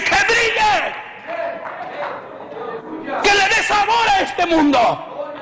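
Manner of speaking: grito agudo y lento al pueblo; grito de predicador. Acted. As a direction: clama que brille, bien fuerte. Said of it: "clamor en el sermón". (grito lento y agudo de predicador)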